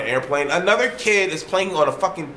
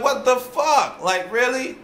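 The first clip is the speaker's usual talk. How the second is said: slow disbelief; 10 semitones higher, slower than usual. Retold or imitated. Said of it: "I was like".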